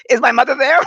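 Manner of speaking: high, loud, comic panic; mock freaked-out squeal. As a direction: squeal it like a spooked client. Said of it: "And I'm like".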